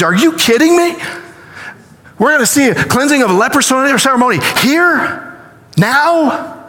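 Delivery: stunned priest can't believe what he is hearing; incredulous excitement. Acted. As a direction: voice leaps up in stunned disbelief. Priest stunned by the cleansing ceremony.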